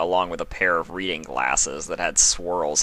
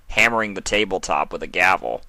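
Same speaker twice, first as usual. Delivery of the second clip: ordinary delivery, nothing marked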